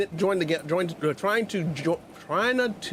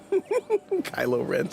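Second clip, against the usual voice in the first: laughing; 3 semitones higher, much slower than usual. Real, not acted. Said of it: "I had to say that".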